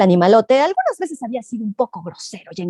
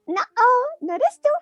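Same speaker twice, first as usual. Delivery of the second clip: high, cheeky rabbit voice; storyteller voicing a bunny; little rabbit voice; 13 semitones higher than usual. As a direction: play the small bold rabbit.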